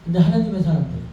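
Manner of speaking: ordinary delivery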